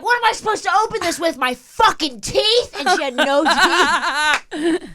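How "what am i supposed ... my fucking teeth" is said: high indignant squawk; irate homeless woman. Retold or imitated. Homeless woman ranting about the can, shrill and high. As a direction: demand an answer, mocking the gift. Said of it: "she's like".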